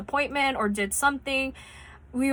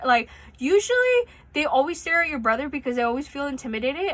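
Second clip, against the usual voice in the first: ordinary talk, nothing marked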